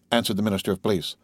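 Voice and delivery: plain reading voice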